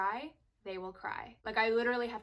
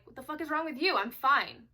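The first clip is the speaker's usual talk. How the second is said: higher, louder irritated comeback; angry snap; 5 semitones higher, 7 dB louder than usual. Retold or imitated. Snapping at someone asking what's wrong, angry. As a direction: bite back at whoever asks. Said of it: "You get angry. You're like".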